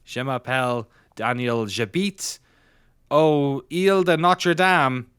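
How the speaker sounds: hammed-up Quebecois French accent; playful Quebecois accent, slowing at the end. Shown as a demonstration. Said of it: "but it's in a Quebecois accent".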